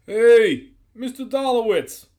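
loud, high holler across distance; distant calling. A narrator's reading. Guard hollering for the boss, calling out. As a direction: call out to someone far off. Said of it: "exchange across some distance".

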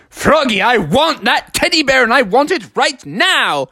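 high, hammed-up tantrum voice; high-pitched mock anger; angry demand. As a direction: demand it like a spoilt girlfriend. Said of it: "Beatrice started getting angry".

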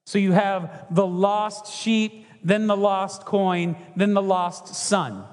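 each item laid out slowly; walk them through the three, one by one; slow teaching rhythm; slow, deliberate listing. Shown as a demonstration.

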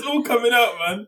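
gleeful. Real, not acted. Gleeful teasing.

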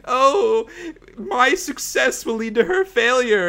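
high, loud hammed-up melodrama; mock sobbing twin. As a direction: overplay a tearful twin. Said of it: "sobbing hysterically".

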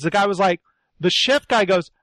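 ordinary talk

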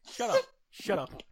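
high, loud squawk of protest; flustered protest. Real, not acted. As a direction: shush teasing friends, voice shooting up. Protests at co-hosts' teasing silence.

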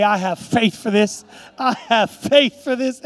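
loud, slowed-down declaration; rallying declaration. Shown as a demonstration. Preacher getting the congregation to say it, loud rallying declaration. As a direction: proclaim it for the crowd to echo.

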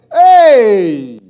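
loud sharp shout; sudden shout. Real, not acted. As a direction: call out to snap attention. Sudden call-out after laugh.

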